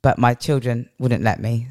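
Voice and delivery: usual speaking voice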